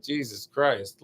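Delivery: ordinary talk, nothing marked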